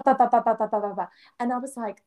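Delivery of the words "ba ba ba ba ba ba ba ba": rapid ba ba drumming; imitated hail. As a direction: mimic hail hitting the window. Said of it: "sounded like someone was just throwing rocks".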